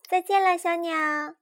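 high, loud farewell call; storybook cheery send-off; calling goodbye. A narrator's reading. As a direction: call out goodbye as you leap away. Characters call goodbye to bird.

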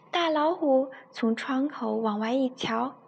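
put-on storytelling voice for a child; story narration. A narrator's reading. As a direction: read it like a bedtime story to a little one. Mother reads bedtime story. (给孩子讲故事的语气)

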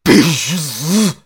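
high, drawn-out war cry; loud stretched battle yell; big shout. Acted. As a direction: bellow it slowly at high pitch. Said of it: "只听那范无咎大喊道".

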